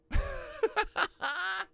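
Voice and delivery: a high, giddy burst of laughter. Real, not acted. Laughs at fooled batter.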